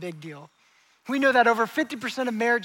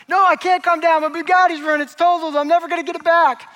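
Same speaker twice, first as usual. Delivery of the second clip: high, loud acted meltdown; frantic, losing it; 7 semitones higher, 7 dB louder than usual. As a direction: rant like a man beside himself over a wreck. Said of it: "he's losing his mind".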